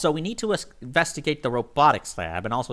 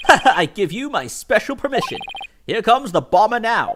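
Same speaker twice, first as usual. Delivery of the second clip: high, loud showman's announcement; hammed-up detective; 10 semitones higher, 6 dB louder than usual.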